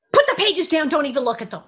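mock-urgent fatherly command; retold urgent order. Father ordering his daughter not to rehearse, urgent.

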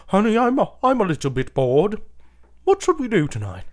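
hammed-up posh British wife; British accent. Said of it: "I need to do it in, uh, British".

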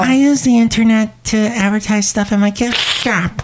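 high sing-song shop lady; Shelley Shuhart voice. Acted. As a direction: gush in a high character voice. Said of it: "It's Shelley Shuhart".

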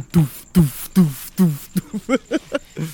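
rhythmic mouthed techno thump; imitated bass beat. Begging to go back to rave music, thumping beat noises. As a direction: pound out a rave kick drum.

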